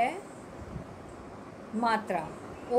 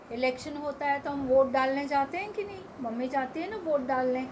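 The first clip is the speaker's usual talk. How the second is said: fast aside; faster than usual. Shown as a demonstration. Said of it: "quick explanation to children".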